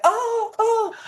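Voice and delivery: high squeaky mock panic; imitating timid girls ducking a Frisbee, high-pitched; mock squeal. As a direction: squeak like a girl dodging a Frisbee. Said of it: "they'd be like".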